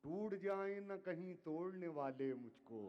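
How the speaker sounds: measured, declaiming poetry to audience; ghazal recitation. Acted. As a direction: recite each couplet with weight. Poet recites line.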